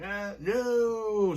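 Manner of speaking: high, loud wail of dismay; hammed-up cry of despair; mock horror. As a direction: wail it like a doomed movie heroine. Heroine discovers she's the witch.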